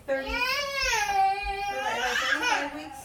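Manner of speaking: high, laughing surprised question. Real, not acted. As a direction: laugh at yourself, unsure. Unsure of her own week count.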